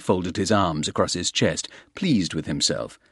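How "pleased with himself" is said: ordinary talk, nothing marked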